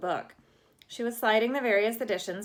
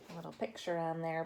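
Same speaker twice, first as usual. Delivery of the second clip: plain reading voice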